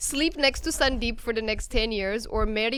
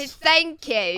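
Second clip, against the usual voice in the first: slow, higher put-on British thanks; mock British accent; 4 semitones higher, much slower than usual. Guest thanking the flirting host, British accent. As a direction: accept coyly in a British accent. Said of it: "a little bit of a British accent".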